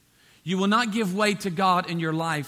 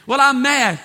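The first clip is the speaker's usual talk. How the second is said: lightly acted child talking back, higher and louder; a hint of a defiant child; mock sulky child; 4 semitones higher, 7 dB louder than usual. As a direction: protest like a child, louder and pitched up. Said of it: "had to tell your child".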